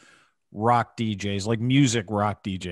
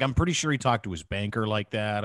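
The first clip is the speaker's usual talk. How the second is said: ordinary talk, nothing marked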